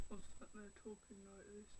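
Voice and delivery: demonstrating a too-quiet voice; barely audible. Shown as a demonstration. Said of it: "you could barely hear me".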